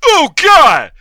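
screamed high and loud, mock horror; mock panicked yell. Rowdy customer yelling at cosplayers in mock horror. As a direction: shriek in fake horror at the freaks.

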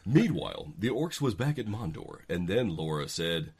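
normal talking voice

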